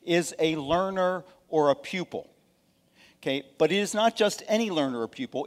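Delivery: ordinary delivery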